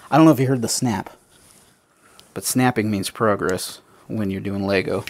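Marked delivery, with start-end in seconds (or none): none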